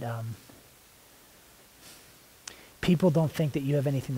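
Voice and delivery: earnest spoken prayer, drifting soft and hushed as it goes on; heartfelt prayer. Real, not acted. Praying to the Lord before the group, intimate and hushed. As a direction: pray it out, pausing to listen.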